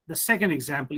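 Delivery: ordinary delivery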